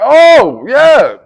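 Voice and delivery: very loud, high cheer; jubilant, shouted celebration; elated realization. Retold or imitated. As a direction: burst out in joyful relief. Retold relief at court news.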